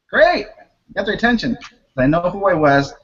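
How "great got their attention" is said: high, bright exclamation; triumphant. Real, not acted. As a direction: cheer it like a win. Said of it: "ironic triumph".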